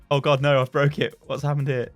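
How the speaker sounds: ordinary talk, nothing marked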